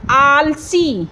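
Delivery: "आलसी" with a big drawn-out "आ"; slow teacher's "आलसी"; long vowels. Shown as a demonstration. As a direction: stretch "आल", then hold the ee of "सी". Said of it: "आ को मैंने बड़ा बोला आ खींच के".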